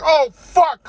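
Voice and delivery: high, loud shell-shocked ant cry. Acted. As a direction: cry out like an ant seeing its dead family. Ants see dead relatives.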